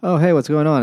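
mock cheery hi; mock casual greeting. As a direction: act innocent, hammed-up. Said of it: "he's like".

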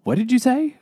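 incredulous. Retold or imitated. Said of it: "people are gonna go".

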